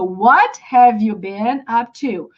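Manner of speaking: careful, slow, word by word; slow it down for learners, each word clear; deliberate teaching pace; slow practice version. Shown as a demonstration. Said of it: "you gotta practice".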